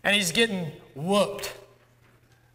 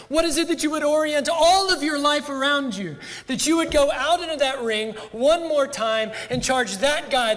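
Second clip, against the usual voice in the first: higher, loud fervent preaching; preaching at full force; 8 semitones higher, 3 dB louder than usual. Acted. Preacher challenging the congregation, fervent higher preaching. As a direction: drive the question home to the congregation.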